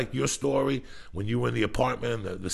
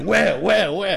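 mock panicked asking; 10 semitones higher, 10 dB louder, much slower than usual. Startled people looking around.

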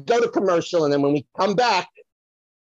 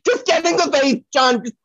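calling offstage; 7 semitones higher than usual. Acted. Psychic calls out to John while fetching a vase.

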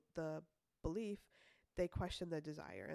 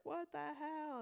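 mock startled; 9 semitones higher, slower than usual. Startled reaction in retold scene.

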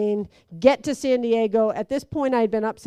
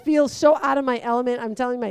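ordinary delivery

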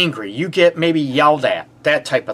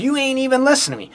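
mock annoyed partner; 9 semitones higher, much faster than usual. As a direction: rattle it off like an irritated partner. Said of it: "they get mad".

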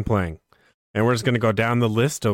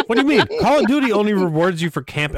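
indignant; 8 semitones higher, faster than usual. Real, not acted. Indignant outburst about Call of Duty.

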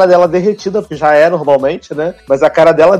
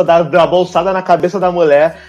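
ordinary delivery

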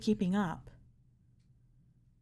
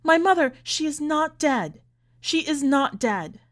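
high, loud protest, slowing into insistence; anguished scream; 7 semitones higher, 6 dB louder than usual. A narrator's reading. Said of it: "she screamed".

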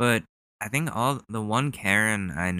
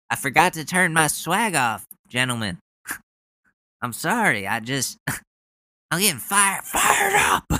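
mock fired-up; 7 semitones higher than usual. Mocking the senator's speech with hammed-up fired-up shouting. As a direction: pretend to be a pumped-up politician.

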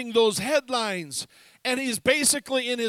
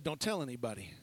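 much softer and lower, hushed; hushed secret; 11 semitones lower, 10 dB quieter, faster than usual. Retold or imitated. Jesus telling his disciples to keep quiet, hushed.

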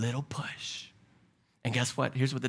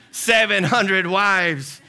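slow, louder incredulous repeat; 5 semitones higher, 7 dB louder, much slower than usual. Real, not acted. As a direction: stress "Seven hundred wives" in disbelief. Incredulous about Solomon.

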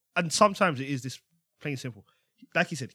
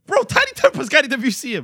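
incredulous outburst; 9 semitones higher, 8 dB louder than usual. Real, not acted. Heated disbelief over Tiny's inclusion.

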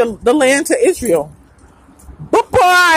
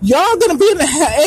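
ordinary talk

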